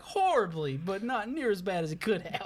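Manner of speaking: usual speaking voice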